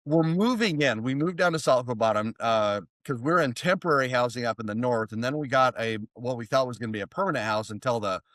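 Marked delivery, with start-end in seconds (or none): none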